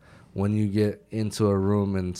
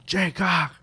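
much higher and louder, calling a downed soldier; yelling a name; 10 semitones higher, 4 dB louder than usual. Retold or imitated. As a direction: shout his name to wake him. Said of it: "Yell his name".

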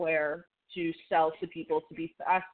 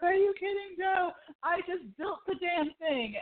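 outraged yell; 11 semitones higher than usual. Retold or imitated. Gordon finds out Joe booked one room, high outraged yell. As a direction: retell Gordon's outburst at full pitch. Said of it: "and he's just like".

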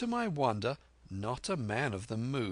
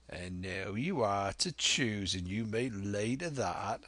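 put-on old sea-dog talking to a lad; Silver, sly old pirate. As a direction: play the sea cook, unhurried.

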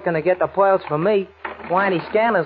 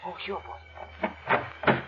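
whispering; 10 dB quieter, much slower than usual. Acted. Aside as Shorty leaves.

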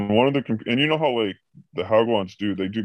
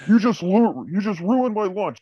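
high, shrill-ish mock complaint; pitched way up as a sulking boss; imitating whiny boss; 11 semitones higher than usual. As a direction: whine it like a spoiled prima donna. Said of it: "He's like".

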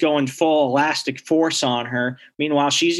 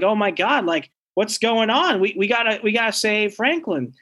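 panicked; 7 semitones higher than usual. Acted. Heroes panicking, running to room.

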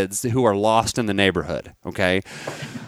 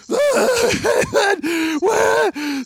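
laughing mock scream. Retelling her screaming.

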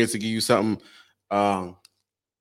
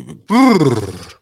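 lip trill; 3 semitones higher, 6 dB louder than usual. Real, not acted.

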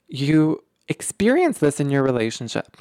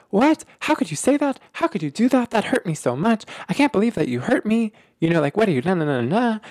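much higher, mock hurt protest; triggered partner voiced in a much higher hurt rush; hurt triggered outburst; 6 semitones higher than usual. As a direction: play the stung partner crying out how could you. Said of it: "They're like".

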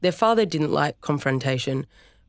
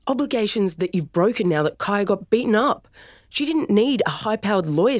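higher, louder, rising anger; heated accusation; 7 semitones higher, 3 dB louder than usual. Acted. Son accusing his father of abandoning his sister, angry and high. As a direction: hurl the blame at him.

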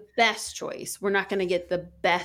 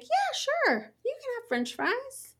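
bright, much higher breezy yes; breezy parent reply. Retold or imitated. Mom replaying her answer to her daughter, high and breezy. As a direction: toss it off up high, cheerfully easy. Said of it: "we'll be like".

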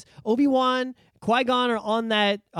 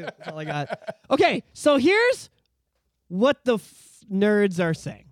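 usual speaking voice

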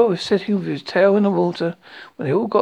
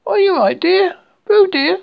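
very high, loud motherly alarm; anxious cry; 13 semitones higher, 8 dB louder than usual. A narrator's reading. Kanga calling to Roo in the water, anxious high cry. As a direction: call out high and anxiously to your child. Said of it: "and Kangaroo anxiously".

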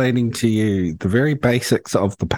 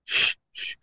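soft unvoiced shushing; whispered shush; 5 dB quieter, slower than usual. Real, not acted. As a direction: hush him quietly. Shushing co-host mid-read.

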